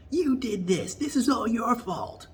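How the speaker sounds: high, put-on accusing voice, slowing on the last words; high mock-outraged Kamala; Kamala's accusation. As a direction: jab the blame at him in a shrill caricature. Kamala bursts in blaming him.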